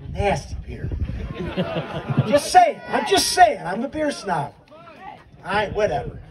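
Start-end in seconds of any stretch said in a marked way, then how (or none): none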